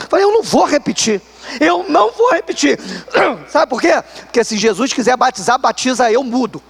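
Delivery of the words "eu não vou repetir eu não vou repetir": rising defiant I won't; a touch of inner defiance; stubborn refusal. Retold or imitated. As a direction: dig in, refusing flatly. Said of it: "tinha trancado meu coração".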